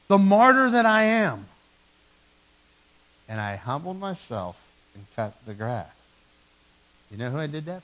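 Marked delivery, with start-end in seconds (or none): 0.1-1.5 louder, higher, hammed-up self-pity; mock martyr. Preacher mocking himself to the congregation, hammed-up martyr tone. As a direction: play the long-suffering martyr for laughs.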